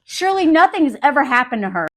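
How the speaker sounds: usual speaking voice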